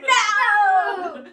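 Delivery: high, very loud wail of "no"; mock protest yell. Player refuses the Taras job, hammed-up high yell.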